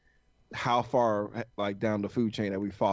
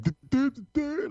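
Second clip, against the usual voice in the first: high, louder mock babble of a dumb voice; mock dumb American; 13 semitones higher, 4 dB louder than usual. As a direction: squeak out a silly duh-duh as a Londoner might hear it. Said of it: "Just like".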